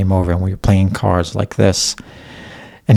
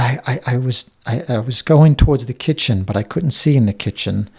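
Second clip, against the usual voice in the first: ordinary delivery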